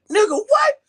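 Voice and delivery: loud, high-pitched disbelief; acted shock. Boyfriend hearing his girl cheats, mock shocked outburst. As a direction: jump up in pitch, outraged. Reacts to news of cheating girlfriend.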